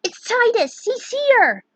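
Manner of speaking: high, loud cry of news; excited shout. Acted. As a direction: burst out the news. Riku bursting with news.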